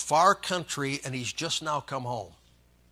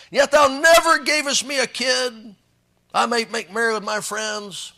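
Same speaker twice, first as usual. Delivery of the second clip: higher, louder resentful whine; whiny older son; 7 semitones higher, 10 dB louder than usual. Acted. Older son grumbles to his father, whining. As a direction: complain like a sulking brother.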